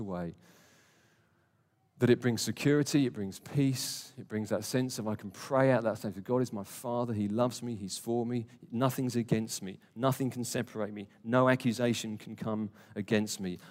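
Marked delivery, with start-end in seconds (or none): none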